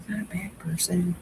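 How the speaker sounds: breathy whispered aside; whispering. Real, not acted. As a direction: whisper it like a confession.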